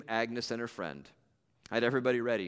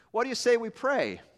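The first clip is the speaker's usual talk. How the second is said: louder suggestion; 10 semitones higher, 5 dB louder than usual. Retold or imitated. Narrator breaks the silence.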